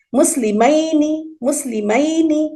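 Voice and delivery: مسلمینی drawn out very slowly, stressing the final 'ni'; teacher spells out مسلمینی slowly so students hear the 'ni'; final ni stressed. Shown as a demonstration. Teacher slowly showing students the 'ni' ending. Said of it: "آخر میں نہ آیا نا آپ کو".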